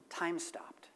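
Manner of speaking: whispered, as the world freezes; hushed whisper. A narrator's reading. Pastor telling the joke, whispering the frozen moment. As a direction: drop to a breathy storyteller whisper.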